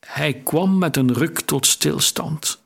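plain reading voice

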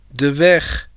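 a touch of hoarseness; brief, lightly hoarse reply. A narrator's reading. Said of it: "zei hij schor". (licht schorre stem)

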